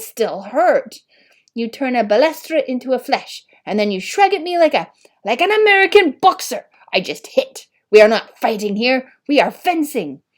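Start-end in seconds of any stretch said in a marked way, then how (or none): 1.5-6.7 loud, high, rising rant; fencing master scolding the boy, furious and loud; furious teacher. A narrator's reading. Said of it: "Monsieur Filleul had been furious". 6.9-10.2 loud, mocking the boy's words, then scolding; fencing master mocking the boy's excuse, loud and angry; furious mocking. A narrator's reading. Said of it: "had been furious".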